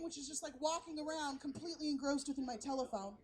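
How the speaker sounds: usual speaking voice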